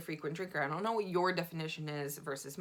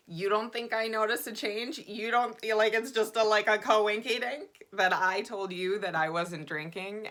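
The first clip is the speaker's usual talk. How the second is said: raised, loud incredulous challenge; mock confrontation; 5 semitones higher, 6 dB louder than usual. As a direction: play at calling him out, pitched up. Mock confronting the man, not present.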